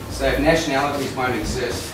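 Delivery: ordinary delivery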